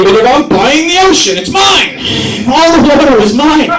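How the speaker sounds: loud, high mock shout of ownership; Jeff Bezos. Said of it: "some story about Jeff Bezos".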